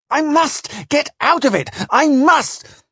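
high-pitched frantic cry; desperate exclamation. Acted. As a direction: cry out as if trapped. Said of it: "I exclaimed".

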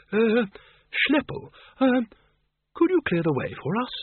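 high, hesitant quaver; timidly asking the giant to move. A narrator's reading. Windle nervously asks the bogeyman, quavering high. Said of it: "Windle quavered".

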